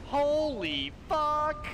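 high, loud drawn-out yell; shocked shout. Real, not acted. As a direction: scream it in shock, slowly. Gets hit hard in game.

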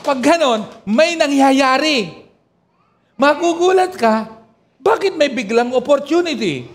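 high, loud, stirring sermon voice; fervent preaching. Acted. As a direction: preach it up to the congregation, rising. Rising to full preaching force.

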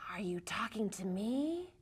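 hissing whisper of a snake. Acted. Snake whispering back at the cowboy. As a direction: breathe it like a lurking snake.

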